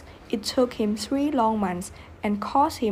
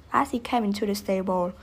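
plain reading voice

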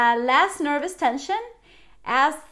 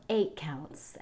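a breathy whispered count; 7 semitones lower, 8 dB quieter than usual. Shown as a demonstration. Coach whispering the count during the exhale. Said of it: "whispered count in breathing cue".

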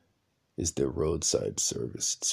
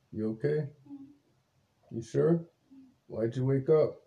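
gentle concern; 8 semitones higher than usual. Real, not acted. Asking his sleepy wife why she's up, gently. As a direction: check on her tenderly.

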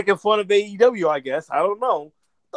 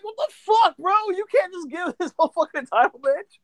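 a high, disbelieving what the fuck, then a laugh; a sudden high burst of disbelief; incredulous; 7 semitones higher, 3 dB quieter than usual. Retold or imitated. Reacting to Brock's free title match, high incredulous outburst.

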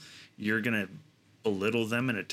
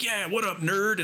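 mock tough greeting; 9 semitones higher than usual. Players teasing the rescued scientist, high mock bravado. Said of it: "And be like".

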